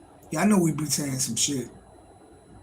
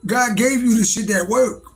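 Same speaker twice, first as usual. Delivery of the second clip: usual speaking voice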